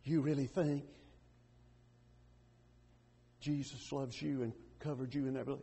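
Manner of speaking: put-on low, hushed voice of the old enemy; quiet, voiced near-whisper with long pauses; insinuating whisper in the ear. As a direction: drop soft and low, planting doubt. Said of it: "he whispers in your ear".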